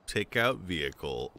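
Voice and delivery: normal talking voice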